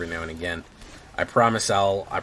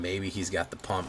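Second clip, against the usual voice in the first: ordinary delivery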